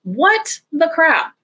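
slow, high-pitched, exasperated outburst; exasperated disbelief. Real, not acted. Critic reacting to the male character's arc, exasperated disbelief. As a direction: draw it out high, appalled at the story.

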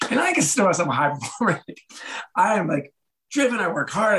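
high-pitched, amused self-defense; laughing protest. Retold or imitated. High performer retelling a poor audit score, laughing protest. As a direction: protest your own worth through a laugh.

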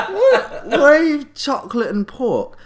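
very high, loud mock squawk of disbelief; outraged shriek. Drunk sister to the French maitre d' about pork terrine, mock outraged shriek. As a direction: shriek it like an appalled drunk diner.

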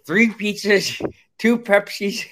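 high, slow, slurred pizza order; Mike McGivern impression. Host imitating a former co-host ordering food, high drawn-out mimicry. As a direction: stretch the order out in a mock voice pitched well up. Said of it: "show with Mike McGivern".

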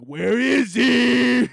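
high, long drawn-out holler; menacing call. Acted. Mobsters hunting anxiety, drawn-out menacing call. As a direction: call out for prey, stretching each word.

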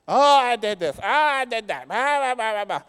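hammed-up whining to God; mock whiny confession. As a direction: whine it like someone replaying old failures. Mocking believers reminding God.

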